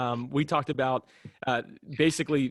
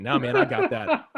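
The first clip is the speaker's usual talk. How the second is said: laughing retort; 4 dB louder, slower than usual. Retold or imitated. Teasing friend over table card.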